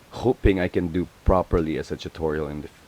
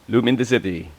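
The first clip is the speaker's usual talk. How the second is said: louder, bright announcement of the name; emphatic; 4 semitones higher, 5 dB louder than usual. Shown as a demonstration. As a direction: Loom in the City called out clearly.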